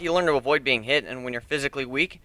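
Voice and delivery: ordinary delivery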